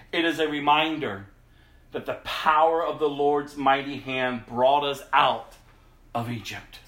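formal Bible reading voice; scripture reading. Acted. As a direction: read the passage to the congregation. Reads scripture aloud.

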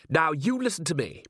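indignant boss taking charge; bossy order. A narrator's reading. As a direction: lay down the law, voice lifted. Factory owner starts ordering Vimes.

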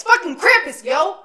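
high, loud hyped yell; excited shout. Real, not acted. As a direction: cheer it like a fan at the reveal. Hyping the monster's reveal.

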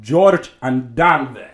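put-on very loud, slow Maltese accent. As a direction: stretch George and Danvers in Maltese accent. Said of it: "making fun of my Maltese accent".

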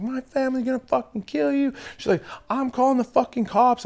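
imitating the drunk girl's threatening calls; mock screaming drunk. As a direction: ham up her threats.

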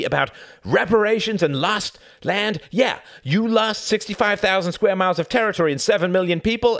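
loud, high, relentless rant; angry tirade. A narrator's reading. Tom berating the bar full of Germans, loud and angry. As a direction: keep shouting them down. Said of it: "he shouted".

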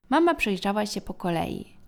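ordinary delivery, nothing marked

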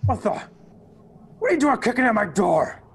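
high, loud angry yell through a door; distant shout. Acted. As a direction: shout it from behind a closed door. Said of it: "Shouting as if at some distance".

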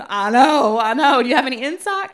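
loud, laughing retold exclamation; excited outburst. Nurse hearing she reads Ezekiel, excited recognition.

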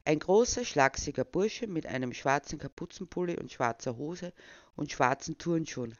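normal talking voice

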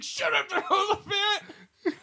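high, slow tearful shout; whining at the bully, slow and high; whiny kid yell. Retold or imitated. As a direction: drag it out like a wailing kid. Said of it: "Just like".

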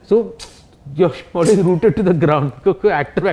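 ordinary talk

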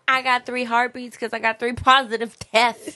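mock a girl bragging while laughing; laughing impression.

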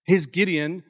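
ordinary talk

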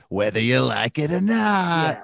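slow, drawn-out villain imitation; hammed-up sinister declaration; mock villain voice. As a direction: stretch it out like a nefarious ad line. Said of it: "the, the, the tone".

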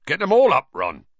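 loud, high burst of cheer; hearty call that drops on the name; cheerful. A narrator's reading. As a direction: cheerfully urge him on. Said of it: "he said cheerfully".

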